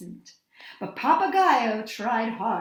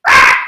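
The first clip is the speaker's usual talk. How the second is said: startled cry; 20 dB louder than usual. A narrator's reading. Creatures cry out in disgust.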